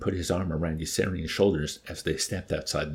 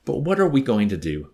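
louder, higher worried question; raised, fretful read-aloud plea; distressed exclamation; 4 semitones higher, 7 dB louder than usual. A narrator's reading. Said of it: "exclaimed with distress".

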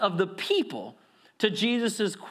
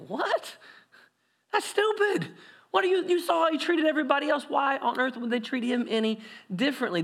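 high, outraged disbelief, rushing; incredulous crowd; 8 semitones higher than usual. Acted. Crowd reacting to Jesus' story, mock incredulous. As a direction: scoff at the stupid idea. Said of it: "The crowd would have looked at Jesus and said".

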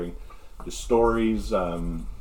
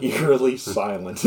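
ordinary talk

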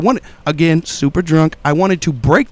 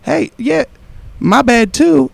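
lightly voiced laid-back bro; breezy high offhand apology; high casual dude voice; 9 semitones higher, slower than usual.

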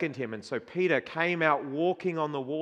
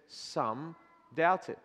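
hushed reading; 6 dB quieter than usual. Acted. Verse repeated hushed.

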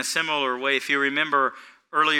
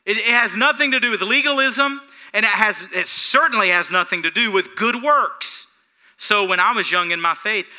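loud, high, emphatic sermon voice; forceful preaching; 8 semitones higher, 6 dB louder than usual. Real, not acted. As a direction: drive each point home from the pulpit.

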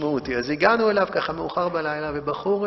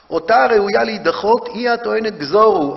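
louder, declaimed quote of the false mother; false mother's claim; 4 semitones higher, 8 dB louder than usual. Retold or imitated. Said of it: "האמא הלא אמיתייה היא זאת שאומרת גזורו". (הכרזה רמה של האם השקרית)

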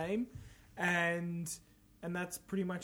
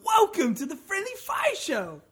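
high-pitched announcer welcome; mock grand show opener; show host intro; 10 semitones higher, slower than usual. Co-hosts echo the show intro.

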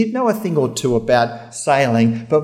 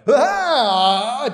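higher, drawn-out "aah"; mock startled scream; 6 semitones higher than usual. People seeing an angel appear, mock startled cry. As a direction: act out a sudden fright.

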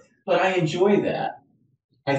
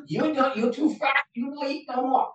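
higher mock Chinese accent; 11 semitones higher than usual. Takeout owner scolding a regular customer, higher mock accent. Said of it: "from a Chinese food restaurant".

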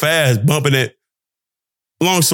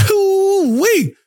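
laughing hoo-wee. Real, not acted. Laughing at his own thoughts.

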